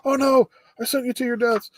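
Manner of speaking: high-pitched mock panic; freaking-out scientist. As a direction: imitate a scientist crying out in alarm. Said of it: "that scientist is freaking out".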